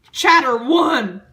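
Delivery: loud, slow and grand chapter title; grand announcement. Acted. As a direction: boom it out like a trailer. Dramatic reading of chapter title.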